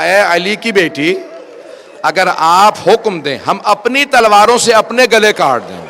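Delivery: loud, high impassioned pledge; calling out. Retold or imitated. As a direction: cry the vow out at full voice. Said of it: "آواز دی". (بلند آواز میں پرجوش پکار)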